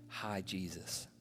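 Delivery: soft, slow, nearly voiceless greeting; faint dying greeting. Retold or imitated. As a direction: breathe it out like a dying man. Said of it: "I hear him say".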